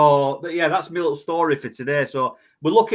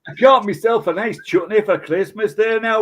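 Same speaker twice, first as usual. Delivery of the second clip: broad hammed-up Scottish accent; 6 semitones higher, 4 dB louder than usual. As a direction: put on a thick Scottish accent. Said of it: "do a Scottish accent".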